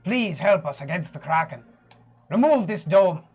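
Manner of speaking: kneeling cleric's rising plea; desperate prayer. Acted. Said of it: "kinda gonna go to one knee and just be like".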